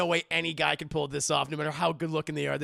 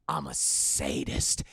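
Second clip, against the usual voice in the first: slow, much lower voice; slow, much lower reveal; ominous low reveal; slower than usual. Retold or imitated. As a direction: drop the voice for a dark confession.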